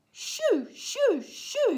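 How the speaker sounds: high, loud bursts; shooing goats. A narrator's reading. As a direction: call out sharply to drive animals off. Boy chasing goats away.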